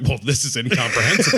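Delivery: laughing through words. Retold or imitated. Host imitating puzzled filmmakers, breaking into laughter. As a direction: quote cracking into laughter.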